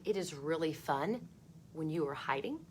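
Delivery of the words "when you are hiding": ordinary delivery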